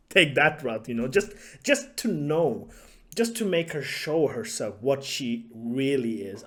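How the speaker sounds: ordinary talk, nothing marked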